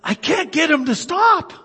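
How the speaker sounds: lightly voiced frustrated elderly lady. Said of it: "and she says".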